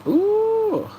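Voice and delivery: high squeal of surprise; surprised ooh. Real, not acted. Streamer squealing at a chat message. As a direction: let out a sudden high ooh.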